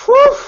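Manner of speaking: loud, very high whoop; high whooping "whew". Real, not acted. Reacting to Alexa Bliss's name, high whoop. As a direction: let out a short, high whew. Wordless exclamation after match names.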